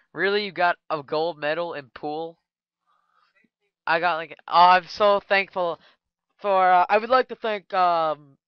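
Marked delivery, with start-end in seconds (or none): 4.5-8.4 loud, hammed-up thank-you speech; a loud, mock-grateful winner at the podium; mock award speech. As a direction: play the gushing trophy winner.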